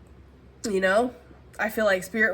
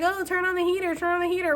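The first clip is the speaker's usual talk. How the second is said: high, whiny imitation of nagging viewers; mocking commenters; 9 semitones higher, faster than usual. Vlogger mimics viewers nagging her about the heater, high and whiny. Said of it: "some of you guys are like".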